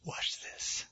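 very soft, whispered aside; hushed aside. Acted. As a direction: whisper it like a confident showman. Elijah's aside before the miracle.

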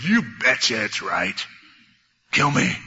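slow, low, stubborn retort; mock-angry Jonah. Jonah answering whether his anger is right, acted stubborn anger. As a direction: answer back in a hammed-up sulk.

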